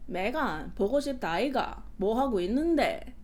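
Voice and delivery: Korean in a swooping Busan lilt; Busan dialect imitation. Imitating a guy from Busan calling her, Busan dialect. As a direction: imitate a Busan man's sing-song Korean. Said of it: "I can just imitate him".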